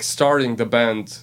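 ordinary talk

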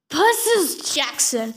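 slow, drawn-out call of a full name; angry yell. A narrator's reading. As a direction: yell the name out slowly, furious. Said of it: "Thalia yelled".

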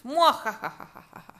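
slow hammed-up villain cackle; slow theatrical evil laugh.

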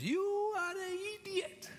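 squeaky high crazy-lady voice; mock insult. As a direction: play the mad lady with the torn umbrella. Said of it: "looks at you and says".

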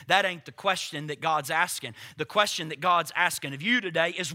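usual speaking voice

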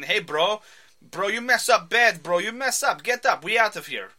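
put-on loud, high Russian-thug accent, bro; tracksuit thug accent. Podcast host voicing the Russian thug threatening Clint, loud Russian accent. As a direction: bark it like an Eastern European tough guy. Ivan threatening in vet office.

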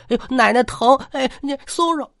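put-on boy gripped by the arm, crying out in pain. A narrator's reading. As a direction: wince as the grip hurts. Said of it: "山娃这边一赠委".

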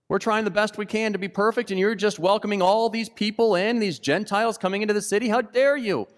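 high, indignant self-righteous voice; offended Pharisee. Acted. Preacher voicing Pharisees against Jesus's welcome, high offended outrage.

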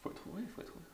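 whispering. Real, not acted. Teasing aside to cohost.

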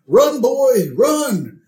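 high, loud hollered warning; Homer. Retold or imitated. Homer to Bart while eating, high hollering. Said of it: "out loud while he's eating".

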